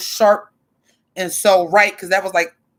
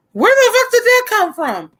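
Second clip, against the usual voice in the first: high shrieking mock shock, louder; shocked friend; 14 semitones higher, 4 dB louder than usual. Friend startled by her sudden country accent, shrieking surprise. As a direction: squeal it like a stunned friend. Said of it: "you'll be like".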